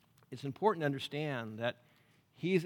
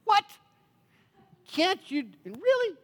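high, baffled protest; can't believe what God allows; incredulous prayer; 13 semitones higher, 4 dB louder than usual. Acted. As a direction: sputter upward in disbelief. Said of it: "my really prayer".

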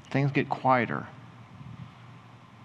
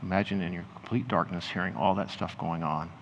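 guided imagining; 2 semitones lower than usual. Acted. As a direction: ease them gently into the scene, unhurried.

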